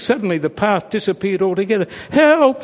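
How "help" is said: high, loud sudden cry for help; falling cry. A narrator's reading. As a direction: yell it while tumbling. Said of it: "she bleated as she fell".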